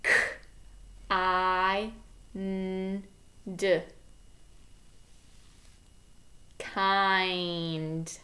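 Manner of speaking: "K, I, N, D" slowly, then "Kind" blended; sounding out. Shown as a demonstration. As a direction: segment four sounds with pauses, then blend. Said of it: "So listen carefully".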